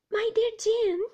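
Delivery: high, loud startled cry; a mother's high-pitched protest. A narrator's reading. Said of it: "cried my mother".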